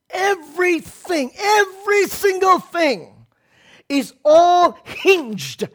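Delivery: Everything, every single thing is all hinged: each word high, slow and drawn out; emphatic high build. Real, not acted. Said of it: "preacher stresses key point".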